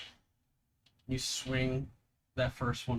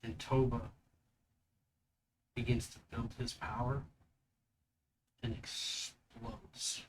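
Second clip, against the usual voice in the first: GM scene narration. Acted. GM describes the vision.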